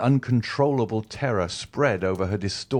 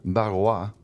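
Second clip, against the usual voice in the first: ordinary delivery, nothing marked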